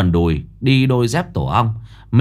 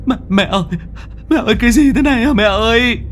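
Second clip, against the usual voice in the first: high panicked shriek for mother, rushing; terrified cry; 12 semitones higher, faster than usual. Acted. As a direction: scream for mom in terror. Tân terrified as skeleton forms.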